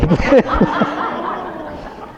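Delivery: high, long burst of laughter. Real, not acted. Telling the Portland story to the audience, high burst of laughter. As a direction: laugh at the punchline.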